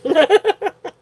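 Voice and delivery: quick high-pitched giggle; giggle, amused by the question. Real, not acted. Laughs before explaining accents.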